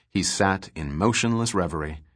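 usual speaking voice

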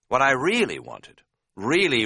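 usual speaking voice

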